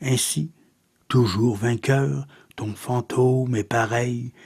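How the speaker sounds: subdued, measured verse reading; poetry recitation. Acted. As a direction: recite softly, with a pause after each phrase. Recites a second poem.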